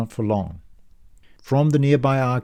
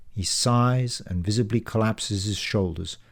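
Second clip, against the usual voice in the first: ordinary delivery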